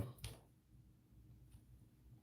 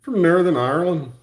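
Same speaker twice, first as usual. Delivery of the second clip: higher-pitched stage Irish; TV-show Irish accent; 7 semitones higher, 3 dB louder than usual. Shown as a demonstration. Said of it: "like, T V show Irish".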